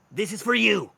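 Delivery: high, loud Welsh proclamation; harsh Welsh accent. Acted. Mushroom man hands Twyla the letter, loud Welsh accent. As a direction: thrust it at them in a Welsh accent. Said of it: "He has this harsh Welsh accent".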